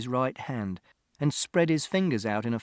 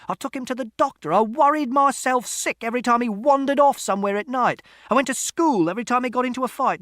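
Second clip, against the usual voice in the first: angry shouted tirade; 11 semitones higher, 6 dB louder than usual. A narrator's reading. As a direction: build from level voice to high shouting. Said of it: "Father shouted".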